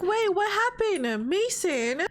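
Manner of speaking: lightly voiced, high-pitched worried girlfriend; concerned Scarlet. Girlfriend seeing her partner's face, concerned. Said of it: "she's like".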